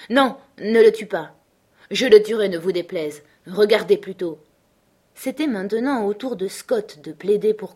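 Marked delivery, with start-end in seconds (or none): 0.1-1.3 louder, pressing no; urgent plea. Acted. Scott begs Matt not to shoot, louder and urgent. Scott pleading to spare the dog. 1.9-4.4 louder, stubborn vow; firm insistence. Acted. Matt insists to Scott he will shoot, louder.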